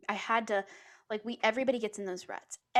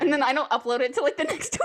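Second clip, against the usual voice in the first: louder, higher, breaking into laughter; laughing confession; 5 semitones higher, 9 dB louder, faster than usual. Real, not acted. Host admitting to late uploads, laughing. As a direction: confess it fast through a laugh.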